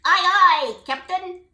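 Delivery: put-on high, loud chirpy young crew; toadlets agreeing. Toadlets answering the captain, eager. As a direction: cheer like little sailors. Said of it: "the toadlets agreed".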